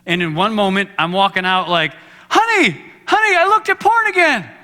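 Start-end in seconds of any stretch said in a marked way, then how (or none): 2.3-4.5 high, loud, sing-song cheerfulness; husband cheerfully confessing to his wife; mock excited. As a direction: announce it like great news, hammed up. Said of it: "didn't sound that excited".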